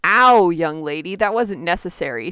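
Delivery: ordinary talk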